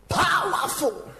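stretched-out high shout of praise; powerful exclamation. Real, not acted. Preacher to congregation, exclaiming in slow awe. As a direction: cry it out long and high in awe. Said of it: "exclaims about the preaching".

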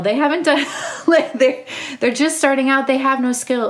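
laughing through the words. Real, not acted. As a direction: giggle while you say it. Laughing at her own point.

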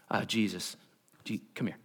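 low, hushed, hesitant summons; discreet aside. Acted. Disciple takes his teacher aside to rebuke him, hushed aside. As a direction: pull someone aside and keep it down. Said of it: "took him aside and began to rebuke him".

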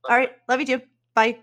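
ordinary talk, nothing marked

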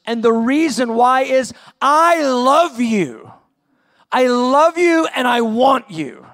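a loving plea that swells into a passionate declaration; Jesus pleading tenderly. Acted. Jesus telling the lukewarm church he loves them, tender rising to impassioned. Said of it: "He's actually coming and saying".

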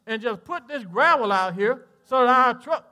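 lightly voiced, laughing stranger; imitating the dirt man. Pastor slipping into the dirt man's voice, laughing. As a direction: slip into his voice with a laugh. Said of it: "I'm talking like him again".

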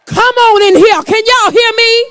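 loud, high preacher's shout, rising in waves; whooping preacher calling the church to answer; full-force preaching. Acted. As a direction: hammer the refrain home to the congregation.

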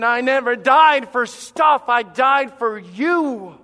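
God's declaration preached at full force; emphatic declaration. Retold or imitated. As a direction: lay each word down heavily. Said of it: "When God said".

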